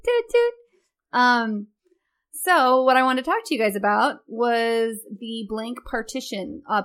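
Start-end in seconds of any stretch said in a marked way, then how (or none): 0.1-0.5 high-pitched toot toot; horn imitation. Co-host explaining she tooted her horn, high toots.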